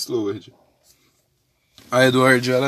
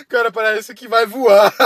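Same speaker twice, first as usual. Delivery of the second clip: laughing; 7 semitones higher, 8 dB louder than usual. Real, not acted. Laughing at flying character.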